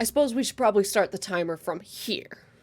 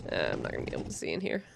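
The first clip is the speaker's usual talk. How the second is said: rushed, much lower and softer; fast low mumble; 7 dB quieter, much faster than usual. Real, not acted. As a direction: rattle it off under your breath. Fast low muttered aside.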